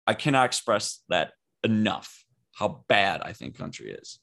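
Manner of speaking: usual speaking voice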